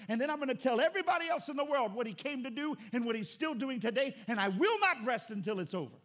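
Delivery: loud, rising preacher's cry; fiery sermon climax; preaching at full force. Acted. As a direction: drive it home to the congregation.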